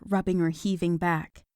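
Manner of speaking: ordinary delivery, nothing marked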